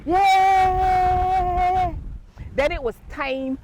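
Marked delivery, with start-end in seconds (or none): 0.0-2.0 high, loud cheering yay; wild crowd yay. Acted.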